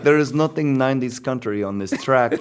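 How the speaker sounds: light Russian accent, amused imitation. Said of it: "With a Russian accent".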